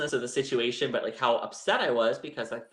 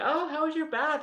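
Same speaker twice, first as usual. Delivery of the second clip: falsely casual. Retold or imitated. Hiding tears, greeting husband.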